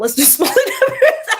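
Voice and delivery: high giggle through the words; laughing. Real, not acted. Admitting bad math to Charles, laughing.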